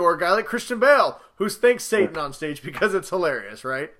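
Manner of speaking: ordinary talk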